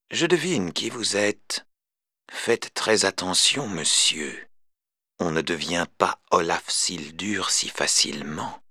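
soft, slow veiled threat; hint darkly, softly; ironic insinuation. Retold or imitated. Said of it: "Sa voix regorgeait d'insinuations ironiques".

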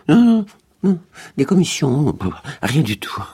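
high, loud flustered stammer; balbutiant. A narrator's reading. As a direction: stammer it out in a panic. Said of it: "Il balbutia". (balbutiant, affolé, plus aigu)

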